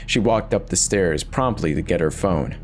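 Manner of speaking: ordinary delivery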